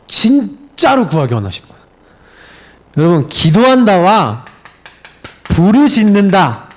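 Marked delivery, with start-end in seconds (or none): none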